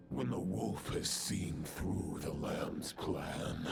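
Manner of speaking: menacing villain. Acted. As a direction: taunt him like a dark knight. Villain speech in a boss fight.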